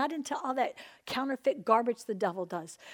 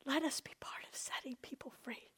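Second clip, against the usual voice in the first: whispered prayer, breath only; 14 dB quieter than usual. Real, not acted. Preacher praying to God for freedom, whispering. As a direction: hush it to God, barely a breath.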